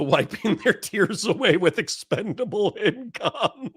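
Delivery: slow, high words broken by laughter; laughing hard. Real, not acted. Host mocking rich libertarians' tears, overcome by laughter. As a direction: struggle through the joke while cracking up. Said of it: "I'm sorry for laughing so hard".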